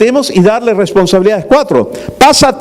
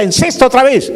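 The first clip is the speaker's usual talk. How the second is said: harsh father's order; 7 semitones higher than usual. Retold or imitated. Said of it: "le decía".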